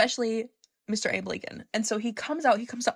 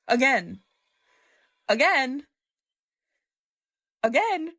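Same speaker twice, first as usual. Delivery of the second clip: each Again higher and louder; building emphasis; 5 semitones higher, 5 dB louder, slower than usual. Real, not acted. Telling viewers the actress came over, with each Again rising. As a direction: stack each Again on top of the last.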